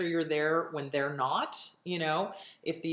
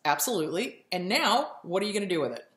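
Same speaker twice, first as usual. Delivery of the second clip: ordinary talk, nothing marked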